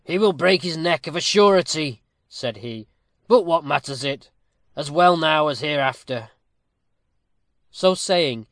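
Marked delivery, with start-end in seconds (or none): 0.0-2.0 raised, louder scornful verdict; contemptuous. A narrator's reading. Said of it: "with much contempt". 3.3-6.3 loud, scornful dismissal; contemptuous. A narrator's reading. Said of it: "with much contempt".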